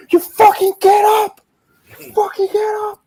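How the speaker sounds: very high, strained mock scream; hammed-up wrestler screaming an order; imitated furious yell. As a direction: screech it like a worked-up cornerman. Acting out wrestler's yell at Punk.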